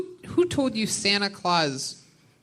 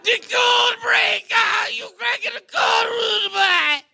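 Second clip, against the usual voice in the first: big, high, stretched Jamaican yell; Jamaican shout; 5 dB louder, slower than usual. Retold or imitated. Host shouting the Jamaican man's line from the movie, high and loud. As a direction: belt it like a hyped Jamaican man. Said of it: "and it's a Jamaican man".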